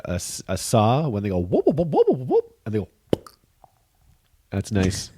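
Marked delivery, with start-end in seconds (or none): 0.0-2.5 whooping sound. Retold or imitated. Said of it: "They sound like a saw".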